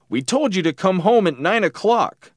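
high, stern parental telling-off; scolding dad. A narrator's reading. Dad reprimanding his late son, scolding.

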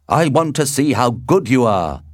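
loud, lifted, goading; challenge. A narrator's reading. Mercutio dares Tybalt before they fight, loud and challenging. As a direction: goad him to prove himself.

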